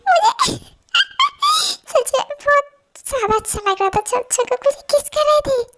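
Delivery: high, giggly lovestruck voice; giddy, laughing. Acted. Recalling the kiss to herself, giddy and giggling. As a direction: bubble over with giggles between words.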